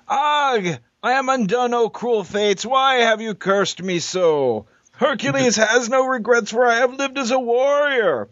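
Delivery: hammy tragic hero. Acted. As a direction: overact a dying Greek hero. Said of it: "thespian extraordinaire Hercules".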